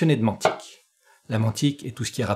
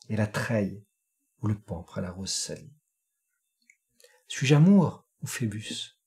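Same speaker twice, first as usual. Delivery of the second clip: récitation de poème; 4 semitones lower, 4 dB quieter than usual. Acted. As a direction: recite it low and hushed, lifting at key turns. (récitation poétique, lente, grave et posée)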